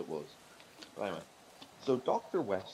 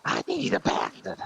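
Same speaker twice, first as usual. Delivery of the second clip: moth voice; 7 dB louder than usual. Acted. Moth character in told joke.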